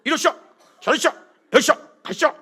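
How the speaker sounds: barked-out orders, high and loud; bossy loud orders. Retold or imitated. Son-in-law ordering his father-in-law to church, loud and bossy. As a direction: order the old man around like a drill sergeant. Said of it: "내가 되게 무섭게 했어요".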